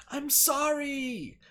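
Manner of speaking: very slow, high, loud sobbing sorry; apologising on command, hammed-up; wailed apology. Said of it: "Say it louder".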